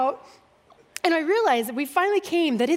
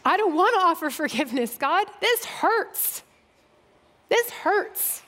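pained plea; 5 semitones higher than usual. Retold or imitated. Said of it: "I'm bawling more".

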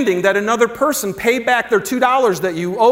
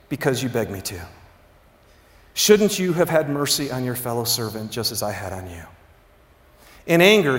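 low, soft, solemn parable reading; scripture reading; 7 semitones lower, 4 dB quieter than usual. A narrator's reading. Preacher reads the servant's story to the congregation, low and solemn. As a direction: read it gravely, sinking quieter at the ends.

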